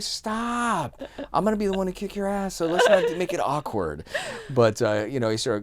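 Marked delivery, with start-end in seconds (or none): 0.0-4.0 high-pitched, mock-exasperated warning; pissed off. Telling off a man bothering the girls, mildly pissed off. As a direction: warn him off in a raised pitch. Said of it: "I kinda got pissed off".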